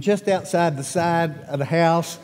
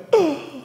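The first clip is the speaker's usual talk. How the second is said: sharp high surprised gasp; crowd gasp; 8 semitones higher than usual. Retold or imitated. Pastor shows the children how the crowd gasped, sharp gasp. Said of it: "did you hear everybody in the room go".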